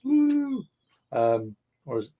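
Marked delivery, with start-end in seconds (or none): 0.1-0.6 high, whooping cheer; playful ballpark whoop; sports cheer. Real, not acted. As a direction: whoop it for the home team. Cheering for the Red Sox.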